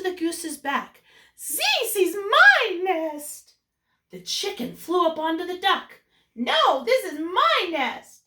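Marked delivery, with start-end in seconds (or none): 1.4-3.6 bird's claim. Acted. Birds fighting over the nest. 6.3-8.2 bird's retort. Acted. Birds fighting over the nest.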